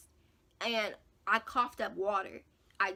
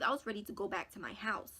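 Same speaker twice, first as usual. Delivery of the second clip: ordinary delivery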